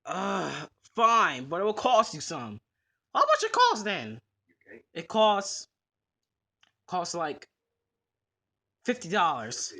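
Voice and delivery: low, gruff pet store manager refusing; grumpy manager saying no; low shopkeeper voice. Acted. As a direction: play the stubborn manager down low. Manager names a price.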